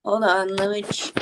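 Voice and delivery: ordinary talk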